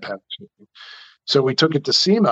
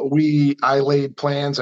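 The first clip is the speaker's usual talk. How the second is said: ordinary talk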